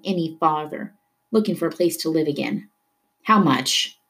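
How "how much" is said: weary. Acted. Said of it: "asked wearily".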